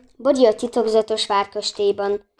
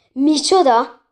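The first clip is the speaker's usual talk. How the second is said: startled loud cry, pitched up; shout; 5 semitones higher, 6 dB louder, slower than usual. A narrator's reading. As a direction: cry out in shock. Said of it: "kiáltotta János". (felkiáltás, hangosan)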